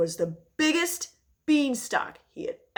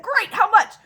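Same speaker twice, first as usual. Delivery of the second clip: excited; 12 semitones higher, 10 dB louder than usual. Acted. Jack eager to sell.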